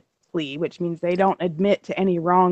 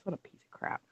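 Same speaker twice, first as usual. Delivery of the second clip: under-the-breath whisper; whispered insult; 18 dB quieter, faster than usual. Real, not acted. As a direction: hiss it to yourself, barely voiced. Muttered insult after story.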